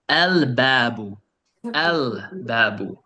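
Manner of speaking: "El babu" with the L held clearly, slowly; drawn-out "El" before "babu"; clearly sounded L. Shown as a demonstration. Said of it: "I'm pronouncing the alif lam clearly".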